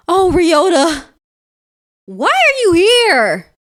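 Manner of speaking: very high, loud, slow cry; startled greeting. Acted. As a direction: gasp his name in shock. Player voices surprise at Ryota.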